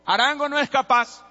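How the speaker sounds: put-on sneering crowd voice doubting the kid; mocking doubt. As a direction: taunt him that he can't do it.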